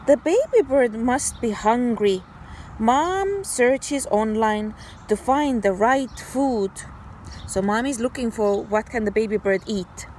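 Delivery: ordinary talk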